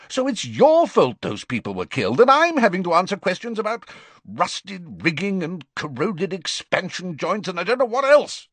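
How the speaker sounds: furious. Acted. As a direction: blame him hotly. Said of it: "said the Prime Minister furiously".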